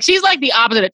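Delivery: normal talking voice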